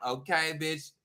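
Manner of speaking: ordinary talk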